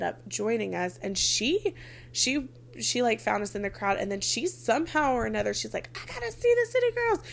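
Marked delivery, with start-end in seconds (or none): 6.0-7.2 high, fast mock eager voice; eager girl. Friend pushing through the crowd, high eager mock voice. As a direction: play the pushy fan who must get close.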